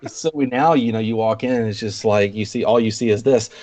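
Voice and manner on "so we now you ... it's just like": ordinary talk, nothing marked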